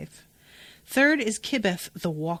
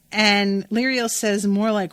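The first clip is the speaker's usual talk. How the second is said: usual speaking voice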